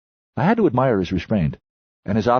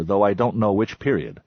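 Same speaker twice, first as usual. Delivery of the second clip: ordinary delivery, nothing marked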